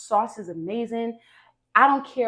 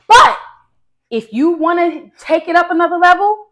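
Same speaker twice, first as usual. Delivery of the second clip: much higher and louder, pushing hard; excited push; 9 semitones higher, 14 dB louder than usual. Real, not acted. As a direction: sell them on taking it up a level. Urging viewers to use MSG.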